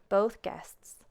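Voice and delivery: usual speaking voice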